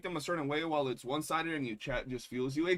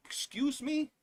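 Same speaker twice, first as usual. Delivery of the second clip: mock polite plea; 6 semitones higher than usual. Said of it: "I'm over here like".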